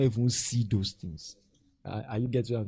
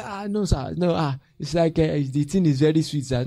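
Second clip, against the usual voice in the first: loud, raised mock protest; sheepish excuse; 5 semitones higher, 7 dB louder than usual. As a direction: act out a disciple pleading his excuse. Disciple excusing himself in acted exchange.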